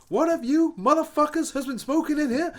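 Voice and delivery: high-pitched, loud mock tirade; telling off. Teetotal rapper scolds the room over smoking, telling off. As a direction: imitate a star furiously scolding the whole room. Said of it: "he's telling them all off".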